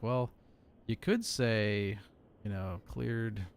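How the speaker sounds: slow, drawn-out mock trainee; mock trainee reading a clearance slowly; imitating a wordy trainee. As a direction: drag it out like a rambling trainee. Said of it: "says so many extra words".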